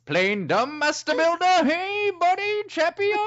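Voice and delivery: very high, climbing cartoon voice; put-on character voice. Shown as a demonstration. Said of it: "what did you expect all of a sudden to come out of my voice?".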